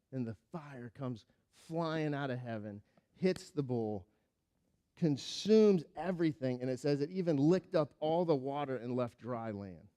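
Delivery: ordinary talk, nothing marked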